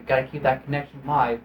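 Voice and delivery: usual speaking voice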